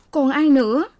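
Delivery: high, plaintive acted reply; lightly acted crying; choked with tears. A narrator's reading. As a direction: answer high-pitched, as if through tears. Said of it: "Cô khóc, nói không thành tiếng".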